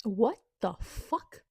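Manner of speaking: slow, drawn-out stunned question; stunned disbelief. Real, not acted. Reader stunned by a plot twist, slow disbelief. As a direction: let the shock stretch the words.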